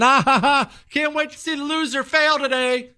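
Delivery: high, jeering laugh; mocking laughter. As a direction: laugh at the loser. Class mocking Chris.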